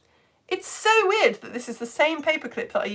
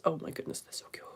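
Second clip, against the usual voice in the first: hushed, breathy whisper of delight; 5 semitones lower, 11 dB quieter, faster than usual. Real, not acted. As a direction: whisper it as if the goats might wake. Reacting to a cute goat picture.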